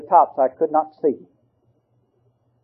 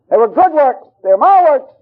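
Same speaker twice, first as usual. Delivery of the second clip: voice of God; 11 semitones higher, 5 dB louder than usual. Retold or imitated. God speaking of works.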